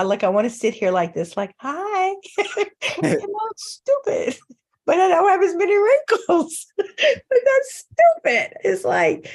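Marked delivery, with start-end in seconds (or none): 1.6-2.1 high, breathy-free coy "Hi" for the camera; a mock glamorous hello; coy greeting. Posing for the Zoom camera, she coos a mock glamorous hello. 2.4-8.6 high, laughing through the words. Real, not acted. Mocking her own vanity about wrinkles, she laughs through it.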